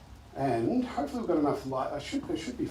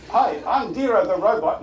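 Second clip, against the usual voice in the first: robot voice; 7 semitones higher, 12 dB louder than usual. Shown as a demonstration. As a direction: model a bold robot voice. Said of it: "in your best robot voice".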